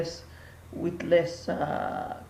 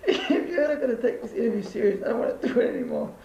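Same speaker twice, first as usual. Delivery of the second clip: laughing protest; 5 semitones higher, faster than usual. Real, not acted. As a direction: complain while cracking up. Breaks up laughing in mock interview.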